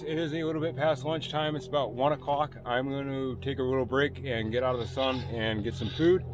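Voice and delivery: ordinary talk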